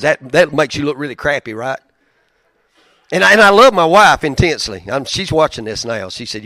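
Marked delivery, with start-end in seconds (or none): 3.1-4.8 much louder and higher, emphatic declaration; loud heartfelt declaration. Real, not acted. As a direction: belt out the love for the wife.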